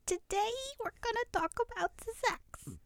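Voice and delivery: put-on high, squeaky German accent; Doctor Ruth's German accent. German accent as Doctor Ruth opening her sex talk show. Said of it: "She had, like, some sort of accent".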